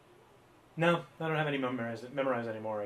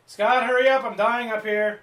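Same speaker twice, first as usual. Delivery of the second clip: louder, higher call to Scott; 10 semitones higher, 13 dB louder, slower than usual. Real, not acted. Stalling host calls loudly to co-host Scott, higher and louder. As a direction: holler across the room for Scott to hurry.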